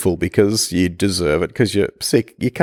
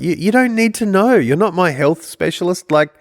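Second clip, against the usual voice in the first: exasperated; 7 semitones higher than usual. Retold or imitated. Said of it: "It's like".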